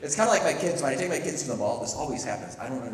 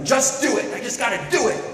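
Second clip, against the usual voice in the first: punchy, louder and higher; emphatic slogan; 9 semitones higher, 7 dB louder than usual. Acted. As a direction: pump yourself up with the slogan. Voicing a try-harder slogan.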